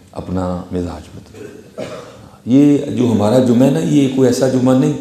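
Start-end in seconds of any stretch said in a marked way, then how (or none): none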